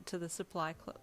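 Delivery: ordinary delivery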